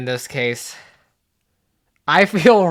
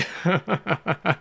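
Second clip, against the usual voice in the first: villainous chuckle; 6 dB quieter than usual. Pretend. Player as evil role gloating at Chris, villainous chuckle. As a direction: chuckle softly at your rival.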